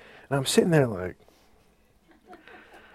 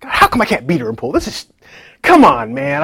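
mock complaint; 3 semitones higher, 7 dB louder than usual. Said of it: "I was just like".

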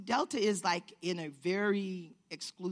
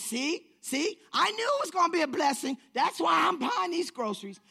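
loud, high and triumphant; imitating a man thrilled to be blessed; exultant declaring; 5 semitones higher, 6 dB louder than usual. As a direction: proclaim it like good news for everyone. Stranger exults over his good deed.

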